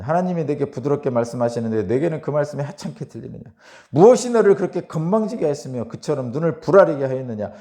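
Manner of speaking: stern reproving reading, building in force; harsh rebuke. Acted. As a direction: scold him hard from the pulpit. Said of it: "책망을 엄청나게 합니다".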